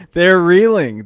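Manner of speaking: loud, high, punched reeling; emphatic shout. Real, not acted. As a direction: shout it to drive it home. Repeats it for emphasis.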